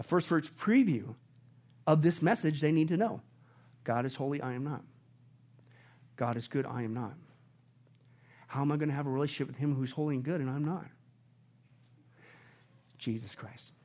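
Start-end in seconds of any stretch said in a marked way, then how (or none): 3.8-13.7 softer, lower, reverent confession; hushed. Real, not acted. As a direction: bring it down almost to a confiding hush.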